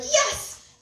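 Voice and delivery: high excited yelp; triumphant yes. Retold or imitated. She retells the moment of decision, a high laughing yes.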